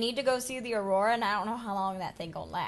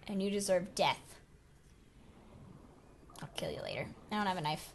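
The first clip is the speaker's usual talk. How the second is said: ordinary talk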